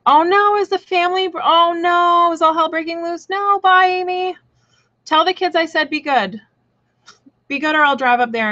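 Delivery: high-pitched, hammed-up panic; mock alarm. Streamer to viewer Amy leaving, in hammed-up high alarm.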